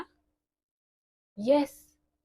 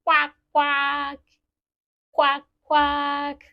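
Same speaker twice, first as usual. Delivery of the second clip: punchy, louder quacks in twos; duck quack; 4 semitones higher, 6 dB louder than usual. Acted. Teacher voicing the yellow duck, loud quacks. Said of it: "It goes".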